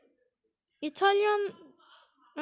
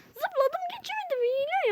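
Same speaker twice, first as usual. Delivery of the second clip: much higher, fading whimper; whiny whimper; 11 semitones higher than usual. Real, not acted. Gamer whimpering that he couldn't get past. Whining at failure.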